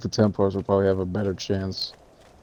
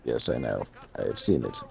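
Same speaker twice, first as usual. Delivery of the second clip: much lower, put-on portentous voice; mock solemn witness; 9 semitones lower, 4 dB quieter than usual. Co-host answers about the baby's birth, mock solemn. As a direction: play a grave all-knowing elder. Mock all-knowing reply to friend.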